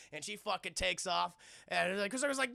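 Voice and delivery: usual speaking voice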